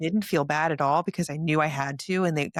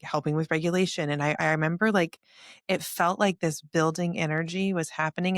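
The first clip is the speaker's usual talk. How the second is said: ordinary talk, nothing marked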